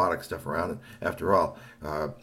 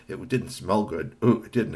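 ordinary delivery

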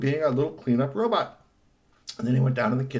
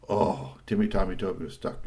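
plain reading voice

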